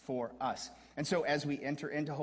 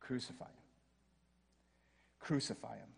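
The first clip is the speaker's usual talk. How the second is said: whispered, very soft; hushed crowd cry; 3 semitones lower, 9 dB quieter than usual. Retold or imitated. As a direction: breathe it out like a dark echo. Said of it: "they would shout".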